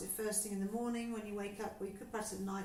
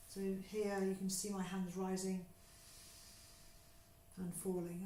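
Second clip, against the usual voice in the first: guided breathing. Acted. Teacher guiding a class through breathing, calm and unhurried. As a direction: talk them gently through each breath.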